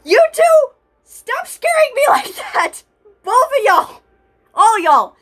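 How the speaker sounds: high-pitched startled shout at friends; startled scolding. Acted. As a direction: yell at them for sneaking up. Scolding friends who scared her.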